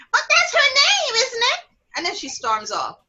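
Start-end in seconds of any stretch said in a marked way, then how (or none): 0.1-1.6 put-on high-pitched child talking back; cheeky little girl. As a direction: imitate a five-year-old's defiant retort. Child talks back to her scolding relative.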